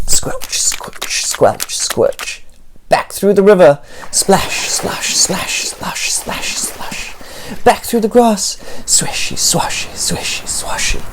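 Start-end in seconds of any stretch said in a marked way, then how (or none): 0.1-2.4 whispered squelch. A narrator's reading. Fleeing back through mud. 4.1-7.1 soft rhythmic whisper fading away; whispered splashes. A narrator's reading. Fleeing back through the river, fading whisper. 8.9-11.0 whispered swishy swashy. A narrator's reading. Fleeing back through grass.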